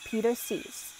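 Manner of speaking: plain reading voice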